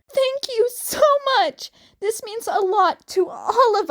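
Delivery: overjoyed thanks. Acted. Hazel gushing thanks to Jason, high and loud. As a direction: burst out thanking him.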